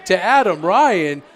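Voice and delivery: live ringside play-by-play; wrestling commentator. Real, not acted. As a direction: call the action as it happens.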